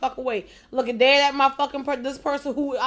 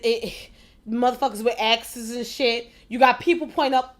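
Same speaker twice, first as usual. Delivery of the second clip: normal talking voice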